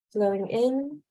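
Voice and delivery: normal talking voice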